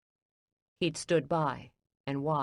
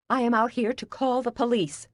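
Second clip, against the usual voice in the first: angry outburst; 7 semitones higher than usual. Acted. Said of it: "I'd never heard her so angry".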